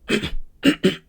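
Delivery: rough throaty cough with a grunt; cough demo. Shown as a demonstration. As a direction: cough like a car motor trying to start. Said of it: "Sounds like a car motor trying to get started".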